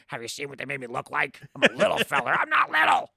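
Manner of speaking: Nolte indignant about his look; ranting Nick Nolte. Retold or imitated. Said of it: "I'm Nick Nolte".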